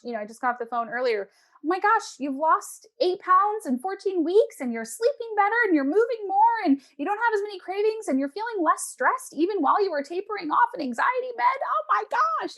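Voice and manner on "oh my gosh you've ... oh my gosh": high, gushing, over-the-top excitement; excited gushing praise. Retold or imitated. Coach gushing to her client over her weight loss, excited. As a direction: gush with delight at her progress.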